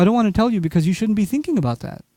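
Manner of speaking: ordinary delivery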